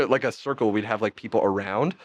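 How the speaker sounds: usual speaking voice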